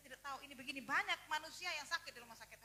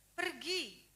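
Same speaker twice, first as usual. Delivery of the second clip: loud, higher one-word order; forceful command; 4 semitones higher, 11 dB louder than usual. Retold or imitated. Holy Spirit orders the believer to go, loud and commanding. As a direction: send them off firmly. God's command voiced in preaching.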